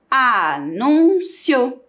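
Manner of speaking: a-nún-cio bem devagar; separando as sílabas. Shown as a demonstration. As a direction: marque cada sílaba de anúncio. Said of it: "Tem mais do que duas sílabas". (anúncio falado lento, sílaba por sílaba)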